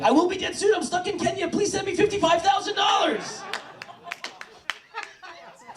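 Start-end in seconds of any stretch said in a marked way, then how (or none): 0.0-3.4 high shouted scammer plea, hammed-up; mock scam email voice. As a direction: beg like a hammy internet scammer. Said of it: "And I'm like".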